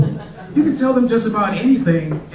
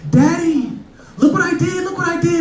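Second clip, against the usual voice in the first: put-on high, eager child calling her daddy; excited little girl; 9 semitones higher than usual. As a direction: squeal it like a proud four-year-old. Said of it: "she's like".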